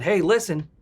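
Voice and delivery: ordinary talk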